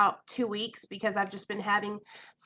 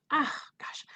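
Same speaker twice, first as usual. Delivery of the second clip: nervous whisper; 5 semitones higher, 5 dB quieter than usual. Real, not acted. Nervous before opening up.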